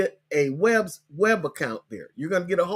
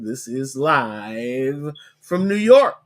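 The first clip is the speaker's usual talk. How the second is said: TV announcer; 3 semitones lower, 4 dB louder, much slower than usual. Pretend. SNL-style show opener.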